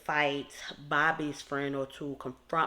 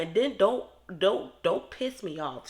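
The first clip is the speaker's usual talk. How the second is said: mock warning; 4 semitones higher, 6 dB louder than usual. Acting out Chicana's warning.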